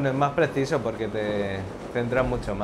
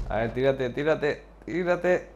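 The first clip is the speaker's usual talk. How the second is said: urging; 4 semitones higher, slower than usual. Real, not acted. Urging another player to jump.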